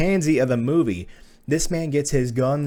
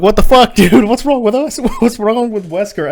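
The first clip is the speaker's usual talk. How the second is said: high, very loud disbelieving yell; comic exasperated rant at full volume; exasperated outburst; 9 semitones higher, 10 dB louder than usual. Retold or imitated. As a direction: yell in baffled outrage, voice shooting up. Exasperated reaction to the film's Wesker.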